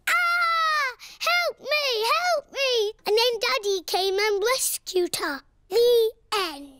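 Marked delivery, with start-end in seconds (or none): 0.1-2.9 playful imitation of a panicked cry; child hamming up a cry for help; mock cry for help. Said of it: "she was going".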